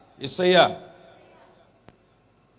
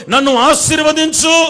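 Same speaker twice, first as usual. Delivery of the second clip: Jacob's plea; 9 semitones higher, 12 dB louder than usual. Acted.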